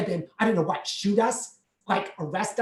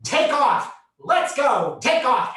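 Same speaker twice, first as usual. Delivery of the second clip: high, loud urging; husband shouting to get going; urgent shout; 7 semitones higher, 6 dB louder, slower than usual. Retold or imitated. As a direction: holler to your partner to flee. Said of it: "That's what Michael says".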